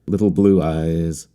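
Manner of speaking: plain reading voice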